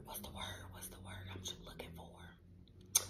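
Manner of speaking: fading into a whisper while searching for a word. Real, not acted. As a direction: drop to a whisper, thinking aloud.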